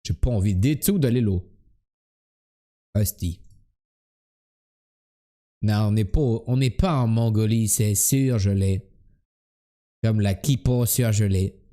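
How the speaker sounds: lightly imitated Québécois; comic Québécois self-talk; Québécois accent. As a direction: put on a mild Québécois accent. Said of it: "ton accent imité".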